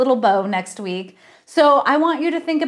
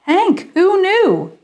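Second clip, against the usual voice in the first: surprised; 10 semitones higher, 5 dB louder, slower than usual. Acted. Stunned quip after the reveal.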